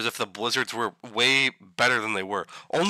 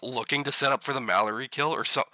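ordinary delivery

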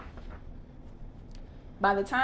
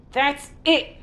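higher, louder exasperated outburst; crying out; 7 semitones higher, 7 dB louder than usual. Acted. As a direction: throw up your hands and shout. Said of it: "cried Timon".

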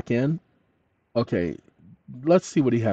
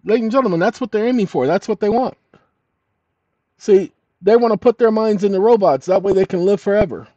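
excited; 7 semitones higher, 4 dB louder than usual. Real, not acted. Excited conspiracy rant to viewers.

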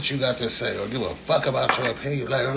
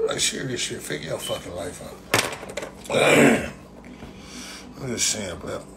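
muttered aside; 3 semitones lower than usual. Real, not acted.

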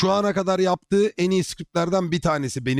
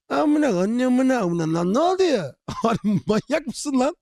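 whiny mock outrage; 8 semitones higher than usual. Mocking an offended player.

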